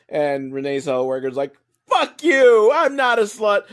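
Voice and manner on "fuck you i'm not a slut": loud, high hammed-up comeback; explosive mimicked outrage; mock angry retort. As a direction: yell back, imitating an offended woman. Acted angry reply in quarrel.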